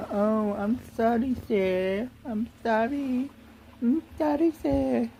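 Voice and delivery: high, whiny mock plea for forgiveness; mocking grovelling apology. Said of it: "don't be saying".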